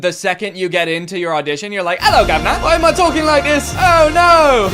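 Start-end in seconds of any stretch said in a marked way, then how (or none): 2.0-4.7 put-on high, loud panicked Cockney-style accent; mock British accent. Nervous auditioner slipping into it unplanned, panicked British accent. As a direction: blurt a hammed-up British accent in alarm. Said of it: "when he said he did the accent".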